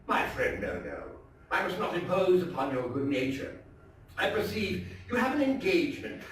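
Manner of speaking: dramatic, theatrical storytelling voice; story narration. A narrator's reading. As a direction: read it like a gothic tale told by the fireside. Dramatic reading of Poe story.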